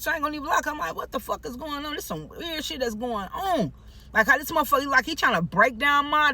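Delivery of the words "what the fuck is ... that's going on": mildly scared, high and soft; retold nervous bewilderment, pitched up and hushed; a little scared. Frightened resident wondering what's going on, mildly scared. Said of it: "I'm a little scared".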